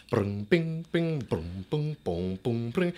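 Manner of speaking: mouthed plucking and strumming noises; vocal imitation of guitar notes; imitated mariachi instruments. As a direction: sound out a mariachi band with your mouth. Imitates mariachi music heard outside.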